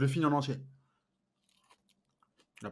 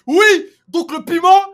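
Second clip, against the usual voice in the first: high, loud hype-up; se motiver; 10 dB louder than usual. Real, not acted. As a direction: pump yourself up before the bite. Se motive avant le piment. (se chauffe à fond, très fort)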